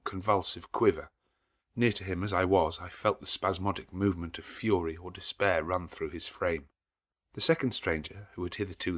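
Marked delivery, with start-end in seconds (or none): none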